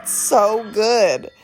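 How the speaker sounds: ordinary talk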